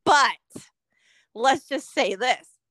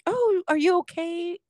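mock concern; 6 semitones higher than usual. Friend mimicking someone checking on a faller, mock concern. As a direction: put on a concerned helper voice.

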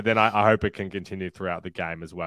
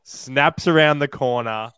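slow, higher and louder build; dramatic climax; 5 semitones higher, 5 dB louder, slower than usual. Acted. Co-host reading the winning kick from a dream, dramatic build. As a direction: stretch it out as the big moment.

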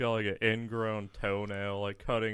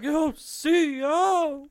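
high, loud, drawn-out holler; mock calling out; 8 dB louder, much slower than usual. Inmate summoning the corrections officer, mock holler. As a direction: imitate an inmate hollering for the guard. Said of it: "He's like".

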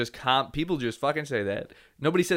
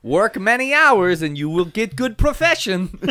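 mock Eastern European; 6 semitones higher, 5 dB louder than usual.

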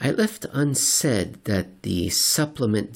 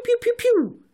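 very high, louder mouth-made pew pew; laser gun noises; 4 dB louder than usual. Pretend. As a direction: zap like a toy ray gun. Sound effect of shots.

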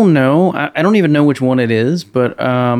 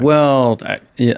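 ordinary delivery

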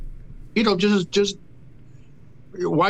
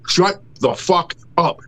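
ordinary delivery

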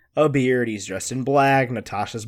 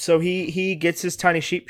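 ordinary talk, nothing marked

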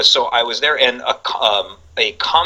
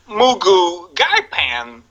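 very slow, higher, weighty dish name; Walken impression; 8 semitones higher, much slower than usual. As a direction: savour every syllable like Walken. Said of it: "he's like".

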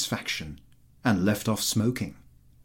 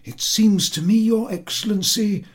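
put-on much higher, keen young officer; eager colonel; 11 semitones higher, 6 dB louder than usual. A narrator's reading. The colonel chiming in to the general, much higher. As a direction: pipe up high and keen to please the general.

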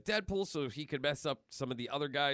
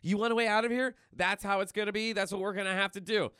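mock higher girl's voice, quick and pushy; Maddie's voice; 7 semitones higher, 3 dB louder, faster than usual. Imitating Maddie bossing Deadpool, mock higher voice. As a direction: pitch it up like a stubborn teen. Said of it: "she's all just like".

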